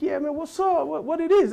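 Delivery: high, slangy American greeting; American swagger. Retold or imitated. Son back from India greeting his dad's friend, mock American swagger. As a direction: swagger it like a homeboy. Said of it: "he's speaking American".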